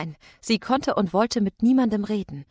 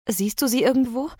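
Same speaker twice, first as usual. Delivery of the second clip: higher impatient question; impatient call; 5 semitones higher, 4 dB louder than usual. A narrator's reading. Sam calls to Mitch round the house, impatient. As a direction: call out with a touch of impatience, rising. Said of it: "rief Sam ungeduldig".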